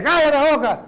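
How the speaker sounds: mock yell from next door; neighbour's yell. Annoyed neighbour yelling at a praying man, mock yell. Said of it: "el vecino dice".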